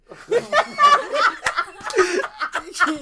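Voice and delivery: helpless, high-pitched cackling; loud belly laugh. Real, not acted. Cracking up at his own story.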